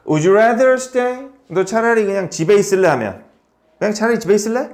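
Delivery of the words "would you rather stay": slow model question. Shown as a demonstration. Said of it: "teacher models question".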